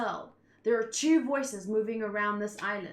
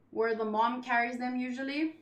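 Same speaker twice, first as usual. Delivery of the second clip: ordinary talk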